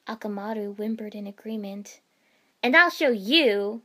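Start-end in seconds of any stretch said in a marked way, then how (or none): 2.6-3.8 loud defiant yell; shout. A narrator's reading. Ino threatening Temari across the arena, shouting. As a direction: yell the threat for all to hear. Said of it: "Ino shouted".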